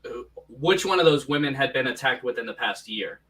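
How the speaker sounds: normal talking voice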